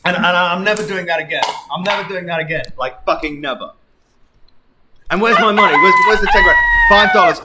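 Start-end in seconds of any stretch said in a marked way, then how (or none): 5.1-7.4 loud demand. Real, not acted. Demanding money from chat.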